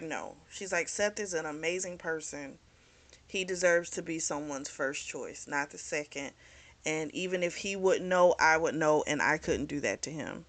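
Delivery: soft, firm refusal; quiet and resolute. Retold or imitated. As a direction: hold the line softly.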